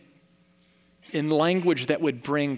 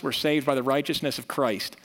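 ordinary delivery

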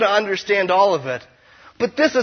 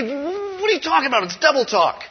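mock skeptic; 9 semitones higher than usual. Mock objection from a listener.

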